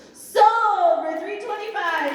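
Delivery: slow, high, loud auctioneer's sale call; drawn-out sold call. Real, not acted. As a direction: stretch it out like an auctioneer's final call.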